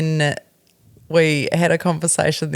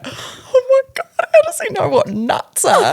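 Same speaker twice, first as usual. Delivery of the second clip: high, laughing oh my God; laughing disbelief. Retold or imitated. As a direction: squeal through laughter in disbelief. Parents shocked at son's question.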